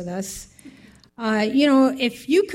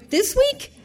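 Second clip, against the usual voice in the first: high, rising, half-laughing disbelief; incredulous question; 14 semitones higher than usual. Retold or imitated. Adoptive mother questioning agency caller, incredulous. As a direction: ask it in high-pitched amazement.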